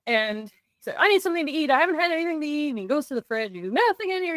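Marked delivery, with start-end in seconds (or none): 1.0-2.7 high-pitched mock complaint; mildly peeved, whiny retelling; mad. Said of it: "he just walks in mad". 3.7-4.4 high-pitched mock grumble; lightly acted, griping retelling; grumbling. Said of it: "He's grumbling".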